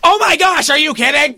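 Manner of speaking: high-pitched burst of amped-up disbelief; amped excitement. Retold or imitated. Host retelling his reaction to the guest booking, amped. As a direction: re-enact being thrilled at the news. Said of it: "I got so amped".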